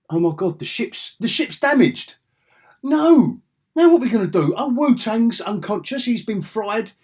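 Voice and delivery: high, loud hammed-up alarm; mock panic. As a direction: play the frantic crew member. Mock panic over damaged ship.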